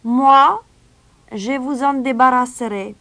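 high, loud, clear stranger's voice. A narrator's reading. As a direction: ring out high above the crowd. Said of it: "une voix claire s'éleva".

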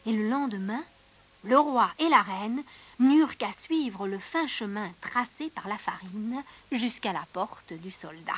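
usual speaking voice

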